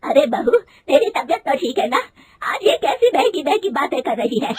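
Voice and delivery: mother-in-law berating her daughter-in-law, loud and high; angry scolding. A narrator's reading. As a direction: scold her hard for wanting a job. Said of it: "गुस्से में कहती है".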